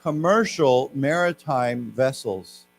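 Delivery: slow, clear model phrase; slow repeat-after-me. Shown as a demonstration. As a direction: say it slowly for students to copy. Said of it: "why don't we say that together?".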